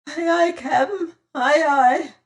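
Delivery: high, loud, eager aye-ayes; eager cowed sailor. Acted. Fat pirate hurrying to obey his captain, high and eager. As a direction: grovel your obedience.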